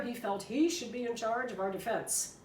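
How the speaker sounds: ordinary delivery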